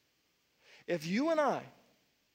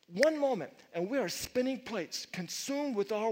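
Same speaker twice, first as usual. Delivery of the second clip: louder emphatic sermon delivery; fervent preaching; 3 semitones higher, 4 dB louder than usual. Acted. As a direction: drive each question at the congregation. Preaching on taking eyes off.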